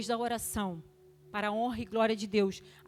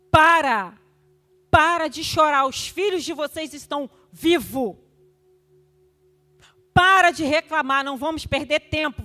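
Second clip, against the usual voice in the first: loud, urgent order to stop crying; commanding shout; 4 semitones higher, 9 dB louder than usual. Retold or imitated. Grandmother ordering crying mothers to stop and pray, loud commanding shout. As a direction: shout down the despair with high, loud authority.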